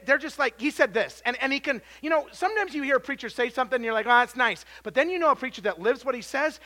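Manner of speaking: loud, high preacher's push; full force preaching. Acted. Preacher urging church to read and give, loud. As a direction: drive it hard at the pews.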